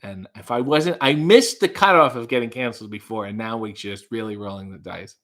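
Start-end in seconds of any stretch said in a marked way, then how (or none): none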